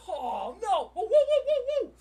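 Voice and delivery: loud, high run of whoas; real startled yelling; alarmed outburst. Real, not acted. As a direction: cry out as the trade swings. Live trade suddenly moving.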